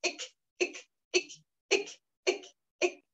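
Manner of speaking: sharp ic, ic with a hard, pushed k; rhythmic ic bursts, the k hammered; hammered ic, ending on the k. Shown as a demonstration. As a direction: snap each ic from the belly. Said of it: "Martelez le que".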